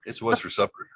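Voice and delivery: punchy announcer, high then settling; hammed-up commercial read; mock ad slogan. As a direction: deliver a silly tagline in earnest. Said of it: "another commercial tag".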